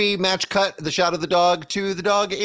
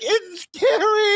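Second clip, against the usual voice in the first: put-on high, slow squeaky kid voice; mock scared child saying it's scary; slower than usual. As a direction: whimper it like a frightened little kid. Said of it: "The kid's like".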